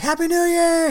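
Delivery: high, loud celebratory shout; mock whooping holiday greeting; imitated crowd cheer. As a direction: cheer like a New Year's crowd.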